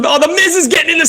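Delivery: high, quick words bursting into laughter; laughing too hard to finish; cracking up. Real, not acted. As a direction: break into laughter mid-sentence. Cracking up at the selfie.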